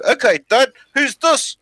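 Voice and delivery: high mock Maori accent, rising question; chief's son. Son testing his dad, high mock Maori accent. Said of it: "and he goes".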